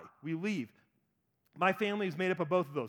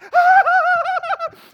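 much higher, louder squealing laugh; mock panicked squeal; 17 dB louder than usual. Daughter fleeing from blood, hammed-up squeal. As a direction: squeal and flee. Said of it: "she's like".

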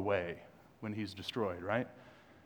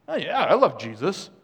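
higher, louder breezy imitation; mock casual churchgoer; 4 semitones higher, 7 dB louder than usual. Preacher mimicking congregation, breezy hammed-up reply. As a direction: parody the careless yes of the crowd. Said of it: "Some of that's you this morning".

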